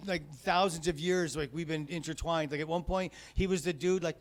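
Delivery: usual speaking voice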